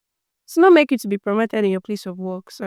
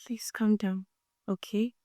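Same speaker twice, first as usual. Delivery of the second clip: gentle, much softer reassurance; hushed calming; 14 dB quieter than usual. Acted. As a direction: quietly settle a flustered person. Soothing the acted panicking person.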